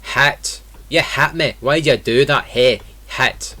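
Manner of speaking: Scottish accent, hit leaning towards "het". Shown as a demonstration. Teacher giving example phrases, Scottish accent. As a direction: put on Scottish, I vowels turned to eh. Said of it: "In Scottish English, this would be".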